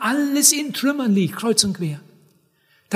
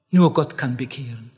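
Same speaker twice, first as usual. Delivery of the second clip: low, mocking echo of the objector; a lower voice for the false teacher's line; 8 semitones lower than usual. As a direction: quote the false teaching with stress on nur. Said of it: "das ist eine richtige Irrlehre".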